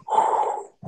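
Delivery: loud, forceful huff of air; big blowing exhale; heavy breathing. Retold or imitated. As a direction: imitate a huge opponent's heavy breath. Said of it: "Big Daddy's breathing like".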